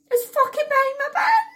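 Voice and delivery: high, loud wail of panic; horrified shriek. Retold or imitated. Reliving finding the rat behind her bin, horrified shriek. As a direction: squeal it in hammed-up horror.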